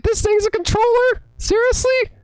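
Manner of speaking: very high-pitched, disbelieving questions; incredulous amazement. Retold or imitated. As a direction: squeak it out in disbelief. Re-enacts disbelief at controller.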